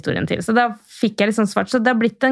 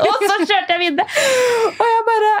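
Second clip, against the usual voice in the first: much higher, words through real laughter; can't stop giggling as you say it; real laughter breaking into the words; laughing; 14 semitones higher than usual. Real, not acted. Laughing while telling.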